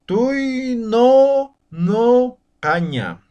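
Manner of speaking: "Toi no, no caña" broken into slow separate syllables; slow chunked pronunciation of toinocaña; syllable-by-syllable demo. Shown as a demonstration. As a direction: split toinocaña into chunks for learners. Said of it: "cambiar la pronunciación de esta vocal".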